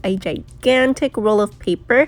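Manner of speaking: loud reading. Real, not acted. Teacher reading the star fact aloud to the class, loudly. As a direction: boom it out to the whole room.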